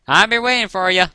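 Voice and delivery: put-on loud, high country-accent agreement; Applejack's country accent. A narrator's reading. Applejack accepts Twilight's promise, country accent. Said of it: "in her unmistakably country accent".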